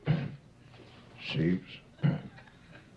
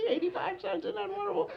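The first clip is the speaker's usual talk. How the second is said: put-on gleeful kid. Kids split stolen money.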